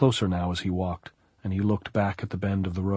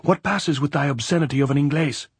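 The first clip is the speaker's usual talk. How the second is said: high, raised-voice demand; angry shouting; 7 semitones higher, 4 dB louder than usual. A narrator's reading. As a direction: shout the question down in exasperation. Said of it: "she shouted".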